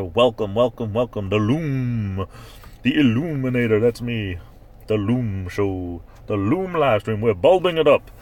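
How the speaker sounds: playful show-host hype, drawn-out names; hammed-up announcer building to a loud finish. Welcoming a viewer to the Loom show, announcer. Hypes own live stream.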